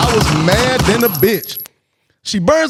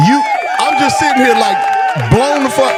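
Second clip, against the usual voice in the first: worked-up disbelief; 4 semitones higher than usual. Real, not acted. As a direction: let the disbelief burst out. Stunned after being kicked downstairs.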